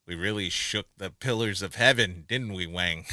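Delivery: lightly attempted low cowboy-star voice; John Wayne. Quoting Jack talking to Wang, attempted John Wayne. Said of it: "Tried to do John Wayne in that".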